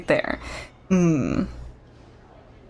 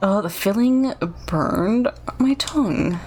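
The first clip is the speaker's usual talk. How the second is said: ordinary talk, nothing marked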